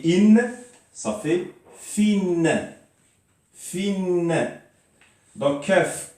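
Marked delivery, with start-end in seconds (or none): 0.1-0.4 in said high and loud as "inn"; tanwin kasra. Shown as a demonstration. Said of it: "Si on rajoute une deuxième". 1.8-4.5 fin held long and high as "finn"; tanwin kasra. Shown as a demonstration. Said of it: "donc ça fait in".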